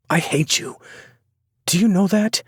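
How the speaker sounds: put-on hurt, angry girlfriend shouting; angry young woman. A narrator's reading. Sloane furious at narrator.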